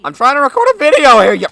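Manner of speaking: high, loud, fast exasperated yell; whisper yelling. Real, not acted. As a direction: yell it at the intruder, fed up. Yelling at intruder while recording.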